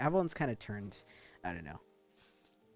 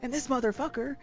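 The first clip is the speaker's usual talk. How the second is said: starts calm, then bursts out higher and louder; calm turning angry; 10 semitones higher, 8 dB louder than usual. Real, not acted. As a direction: build from calm to a laughing rant.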